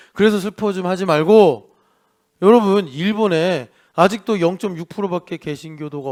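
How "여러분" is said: usual speaking voice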